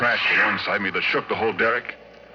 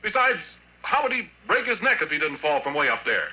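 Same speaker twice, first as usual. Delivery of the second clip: higher, insistent, pleading under questioning; defensive denial; 12 semitones higher than usual. Acted. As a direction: protest your innocence to the cop. Porky questioned by cop, defensive.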